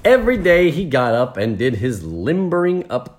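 animated, lilting storybook reading; story narration. A narrator's reading. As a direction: read it brightly to the listeners. Reads the story aloud.

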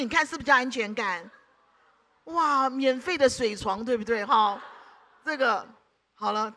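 ordinary talk, nothing marked

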